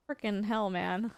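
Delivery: usual speaking voice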